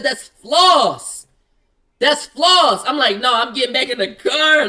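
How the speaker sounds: loud, insistent pushback at the cops; hammed-up retold outburst at the officers; exasperated protest. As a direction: protest loudly that it's only floss. Protesting to cops about floss.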